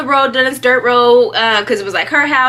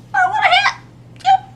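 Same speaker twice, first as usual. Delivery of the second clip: very high, drawn-out squeal; mock panic; slower than usual. Child told she is bleeding, high mock panic. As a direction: squeal it in imitated alarm. Acts out shock at being bleeding.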